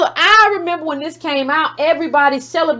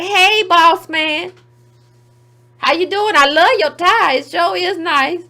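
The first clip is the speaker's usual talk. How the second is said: higher, slow fawning intern voice; mock intern flattery; 4 semitones higher, slower than usual. Jay-Z imitated as a fawning intern to his boss. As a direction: suck up to the boss. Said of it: "He look like".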